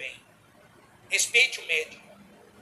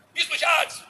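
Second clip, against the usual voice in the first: loud, high recognizing shout; excited call out; 9 semitones higher, 9 dB louder, much slower than usual. Retold or imitated. Woman in the street recognizing the bishop, loud exclamation. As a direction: call his name out in surprise.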